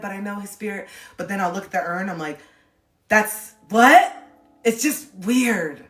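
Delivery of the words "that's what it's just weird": stunned disbelief. Retold or imitated. Looking at dad's urn.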